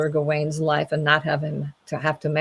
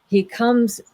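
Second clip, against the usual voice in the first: ordinary delivery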